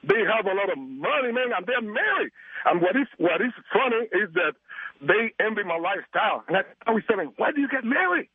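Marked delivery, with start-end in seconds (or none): none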